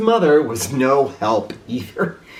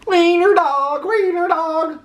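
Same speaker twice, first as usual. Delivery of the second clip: taunting dogs; 3 dB louder than usual. A narrator's reading. Dogs jeering at Oscar in high sing-song. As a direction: tease him in a mocking chant. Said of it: "laughed the other dogs".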